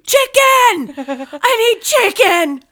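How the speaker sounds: high, loud mock-starving shout; desperate yell. Guest answers the front desk again, desperate yell. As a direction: holler it as a hammed-up plea, stretching the end.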